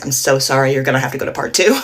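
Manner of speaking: ordinary talk, nothing marked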